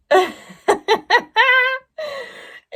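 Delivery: high, loud fit of giggles; long hearty laugh. Real, not acted. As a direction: burst out laughing all over again. Laughing at the fabric.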